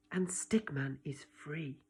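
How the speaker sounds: soft relieved whisper. Acted. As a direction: breathe it out softly. Hushed narration line.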